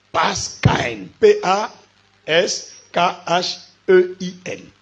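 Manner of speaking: "Paschaïn" in two drawn-out parts, ending like "Aïn", then slow letters; slow teacher's demonstration of "Paschaïn"; spelled-out pronunciation. Shown as a demonstration. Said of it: "Comme Aïn".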